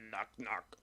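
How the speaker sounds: hushed, low imitation of a possessed girl; softly imitated low voice. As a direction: say it low and quiet, imitating her. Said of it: "in, like, a really low voice".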